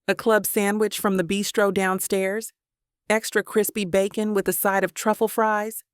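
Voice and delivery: ordinary delivery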